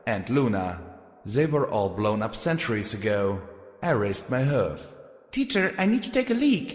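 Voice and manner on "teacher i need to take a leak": put-on high, quick young filly. Filly character voice.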